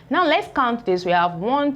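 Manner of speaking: ordinary talk, nothing marked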